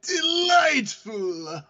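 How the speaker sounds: very drawn-out high exclamation; mock-theatrical cry of delight; hammed-up echo. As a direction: stretch the word high and grand. Echoes the quote with relish.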